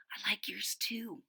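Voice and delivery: soft unvoiced whisper. Angela whispering to Ruby in class, hushed. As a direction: breathe it secretly across the desk. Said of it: "whispered Ruby".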